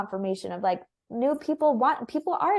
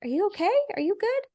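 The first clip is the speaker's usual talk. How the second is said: much higher, gently worried checking in; concerned cousin; 11 semitones higher, much faster than usual. Retold or imitated. Cousin checks on her after she fell, concerned and high. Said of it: "he's like".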